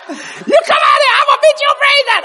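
high, loud mock mother yelling with laughter; hammed-up angry mama; mama's threat.

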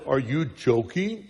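low, soft tortoise voice; lightly voiced tortoise, quiet and low. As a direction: put on a low, understated tortoise voice. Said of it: "seems to me to be a tortoise voice".

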